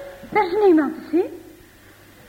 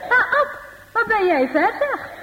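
cheery greengrocer; 6 semitones higher than usual. Acted. Greengrocer hailing Ab.

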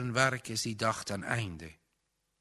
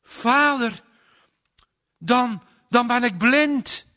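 a loud, much higher cry of terror; rauwe kreet; 12 semitones higher, 11 dB louder than usual. A narrator's reading. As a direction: cry out in sudden horror. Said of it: "een rauwe kreet". (rauwe angstkreet)

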